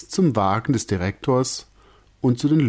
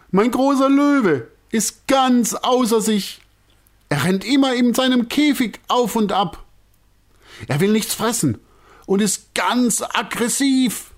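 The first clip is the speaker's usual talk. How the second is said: flustered director; 13 semitones higher, 4 dB louder than usual. A narrator's reading. Director telling troupe about his lion, high and fretful. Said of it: "völlig durcheinander".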